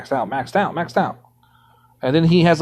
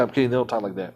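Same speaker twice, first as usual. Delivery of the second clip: ordinary talk, nothing marked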